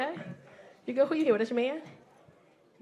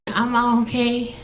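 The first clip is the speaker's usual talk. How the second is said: mock drunk, slurring sweet talk; tipsy wife; 3 semitones lower than usual. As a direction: play a tipsy girlfriend gushing on the phone. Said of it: "she start her shit".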